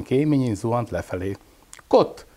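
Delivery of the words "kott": high, bossy chicken cluck. A narrator's reading. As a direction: cluck it like a self-important hen. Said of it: "fontoskodott Tyukica".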